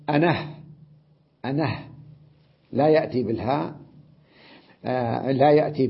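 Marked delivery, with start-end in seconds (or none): 0.1-1.8 "أنه" ending on a breathy h; pause with ha. Shown as a demonstration. Teacher demonstrating to students "أنه" with a final h. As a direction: finish "أنه" on an audible h instead of a long a. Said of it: "أن يقول مثلًا".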